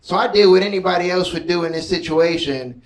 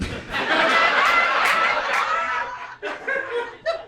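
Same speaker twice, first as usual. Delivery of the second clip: high shrieking laugh. Real, not acted. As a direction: burst into a squealing laugh. Big laugh after bit.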